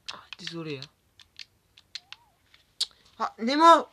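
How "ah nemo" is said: cri aigu et fort. Real, not acted. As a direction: crier de surprise. Crie soudain en jeu. (exclamation criée)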